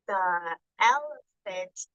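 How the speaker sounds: each word drawn out very slowly; slow read-along. Shown as a demonstration. Teacher leading the class through the page, very slow. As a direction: leave room for the kids to join in. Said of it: "Help me out".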